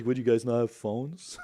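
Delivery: P=A; New York accent. Retold or imitated. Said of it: "old-timey New York accent".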